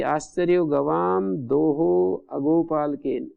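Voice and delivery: slow, word-by-word recitation of आश्चर्यो गवाम दोहो अगोपालकेन. Shown as a demonstration. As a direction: spell out the example slowly for the class. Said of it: "गवाम दोहा इत्यत्र समासो न भवति".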